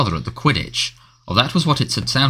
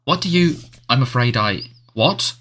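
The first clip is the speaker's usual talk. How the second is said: usual speaking voice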